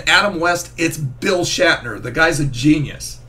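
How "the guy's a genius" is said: ordinary talk, nothing marked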